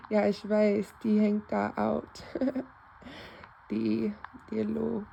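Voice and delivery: ordinary talk, nothing marked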